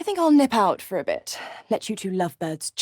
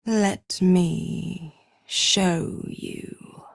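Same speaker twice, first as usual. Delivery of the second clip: very slow, half-whispered invitation; slow, teasing villain's whisper; drawn-out menace; much slower than usual. Acted. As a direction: draw out each word, fading into breath. Menacing offer, slowly drawn out.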